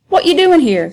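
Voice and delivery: high, teasing dialect voice; voiced Sambo. Sambo teasing Tom, in a high joking voice.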